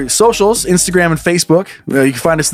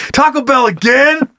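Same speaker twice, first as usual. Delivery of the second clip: disgusted toilet; 8 semitones higher, much slower than usual. Pretend. Host playing the disgusted toilet.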